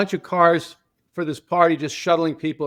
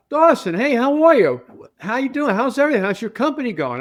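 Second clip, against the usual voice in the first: bright, raised voice, pitched well up and louder; excited greeting; 7 semitones higher, 3 dB louder than usual. Retold or imitated. Brady surprised to see his old driver, greeting him excitedly. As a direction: greet an old friend with real delight.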